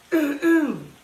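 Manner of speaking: sudden loud coughing fit; choking cough. Real, not acted. Choking on her own saliva mid-recipe, loud coughing. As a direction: hack hard like something went down wrong. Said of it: "I choked on my own saliva".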